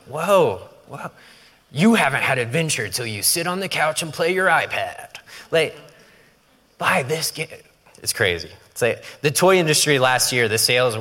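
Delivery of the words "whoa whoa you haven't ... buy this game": lightly higher announcer voice; mock commercial hype. Toy commercial pitching games to kids, lightly hammed. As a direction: sell the iPad like a toy ad. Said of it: "It's like, like".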